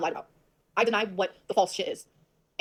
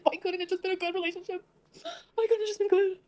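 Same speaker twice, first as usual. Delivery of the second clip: near tears; 9 semitones higher than usual. Real, not acted. Upset over ex's lies, voice cracking.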